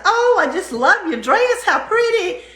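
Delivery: high, slow, gushing praise; gushing compliment. Retold or imitated. Friend complimenting a dress, high and gushing. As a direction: gush over a friend's dress. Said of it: "come up to you and say".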